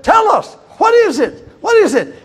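high, eager begging; eager plea. Acted. Crowd begging to learn the secret, eager. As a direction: press him eagerly for the secret.

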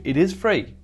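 ordinary talk, nothing marked

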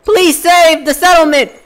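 high, loud hammed-up pleading; over-the-top desperate militiaman yelling; desperate shouting. Preston character screaming and begging.

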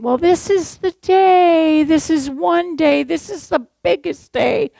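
a light impression of an insistent bride; imitating the bride. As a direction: play the bride pleading for her big day. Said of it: "the bride kept saying".

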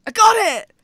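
loud high triumphant yell; excited shout. Real, not acted. Finally hitting the lizard, a loud triumphant shout. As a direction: shout it out as the shot lands.

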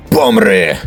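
loud battle yell; attack shout. Acted. Fighter striking back at a rival, loud attack shout. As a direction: hurl it hard at the opponent.

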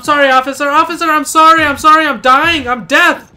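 loud, high frantic sorry; panicked apology. Real, not acted. Apologising to the officer while being hit, frantic pleading.

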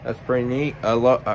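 talking around pipe stem; pipe in mouth. Real, not acted. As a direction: teeth gripping pipe. Seen 0: Pipe stem clenched in teeth until 2.0s, then removed.

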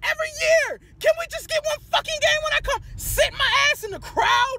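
high, cracking shouted rant; crying out at the team in frustration; tearful rant. Real, not acted. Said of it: "I'm sorry, I had to vent".

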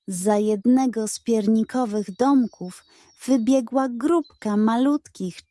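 ordinary delivery

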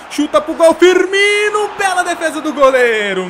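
high, loud shout of the player's name; shouted commentary. Real, not acted. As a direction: yell it as the shot goes in.

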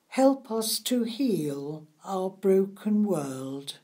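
normal talking voice

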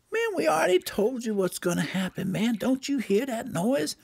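very high, chattery bird character; high cartoon bird voice; excited Mexican bird. Mexican bird answers the boys.

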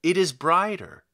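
a touch of an amazed shout; crying out. A narrator's reading. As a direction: call out in awe. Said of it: "cried out at the sight of it".

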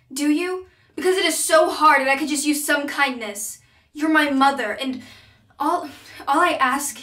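acted exasperated plea, loud and high; upset confrontation. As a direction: plead with your mother, voice rising in frustration.

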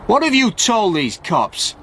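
high, slow, accusing question; angry shout. A narrator's reading. Stewart confronts Frank in front of the police, shouting angrily. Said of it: "Stewart shouted angrily at Frank".

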